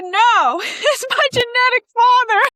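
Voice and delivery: high, loud laughing shout; shouting laughing answer. Retold or imitated. Answering the teacher in clown class, laughing shout. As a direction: blurt it out through laughter. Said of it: "I said".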